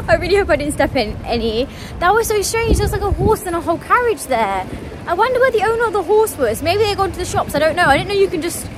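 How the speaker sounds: high, loud, amazed chatter; buzzing with disbelief; excited. Real, not acted. Surprised to find a horse.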